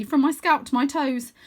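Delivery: plain reading voice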